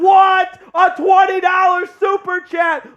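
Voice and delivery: excited surprise. Real, not acted. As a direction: blurt it out amazed. Surprised at donation.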